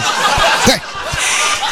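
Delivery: airy unvoiced laugh; a breathless laugh at his own joke; breathy laugh. Real, not acted. Comedian laughing at the scorpion punchline, breathy laugh.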